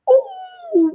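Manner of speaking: long, very high "ooh"; drawn-out high coo of delight. Real, not acted. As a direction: squeal an excited ooh. Delight at Jersey Mike's mention.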